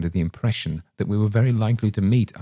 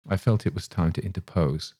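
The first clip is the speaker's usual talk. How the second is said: usual speaking voice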